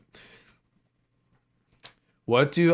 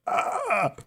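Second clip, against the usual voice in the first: much higher mock yelp from the heat; overheated yelp; 11 semitones higher than usual. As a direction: cry out as if scorched. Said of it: "I'm like".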